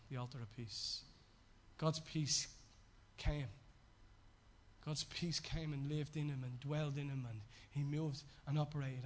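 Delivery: low, soft, reverent sermon voice; hushed preaching. Acted. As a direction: preach it quietly and low, letting each phrase settle. Preacher drops into hushed reflection.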